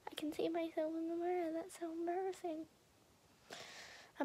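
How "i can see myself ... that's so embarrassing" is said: quick embarrassed whisper. Real, not acted. Embarrassed to viewers, a quick whisper. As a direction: whisper it fast, as an aside. Aside about seeing herself.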